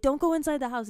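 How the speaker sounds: high panicked yell; frantic shout. Retold or imitated. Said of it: "And she's like".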